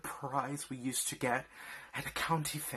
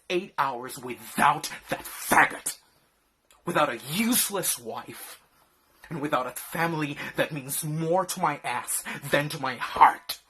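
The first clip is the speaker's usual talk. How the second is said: loud furious rant; furious shouting; 8 dB louder than usual. Acted. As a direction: yell it, building to a roar. Explodes at wife.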